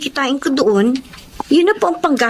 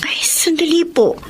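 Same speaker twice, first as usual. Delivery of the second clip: whisper; 4 semitones higher than usual. Acted. Student to Sir Ramon remembering something, whispered. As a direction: whisper as it dawns on you.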